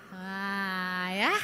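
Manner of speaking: ordinary talk, nothing marked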